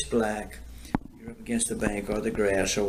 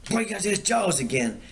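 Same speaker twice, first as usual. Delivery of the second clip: high, excited teasing shout of a warning; mock warning call; 10 semitones higher than usual. Teasing his fishing buddy in the dark boat, high mock alarm. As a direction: sell the fake alarm with a much higher voice.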